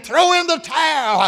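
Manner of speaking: retold ringside shout, pitched high; crowd hollering. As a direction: holler it like the fans at the ring. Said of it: "started hollering at him".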